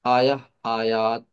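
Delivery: आया then आयात, drawn out slowly; slow singular and plural. Shown as a demonstration. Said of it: "इसकी plural जन्नात".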